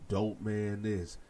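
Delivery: ordinary delivery, nothing marked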